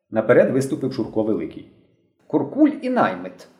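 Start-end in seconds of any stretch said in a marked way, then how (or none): 2.3-3.5 slow, higher, mildly pompous; a touch of dignified gravity; self-important. A narrator's reading. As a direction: announce the title with grave importance. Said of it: "поважно промовив він".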